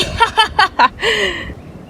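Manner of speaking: long high villainous cackle; drawn-out witchy cackle; evil cackle. Acted. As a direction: laugh like a freed villain. Villain gloats after escape.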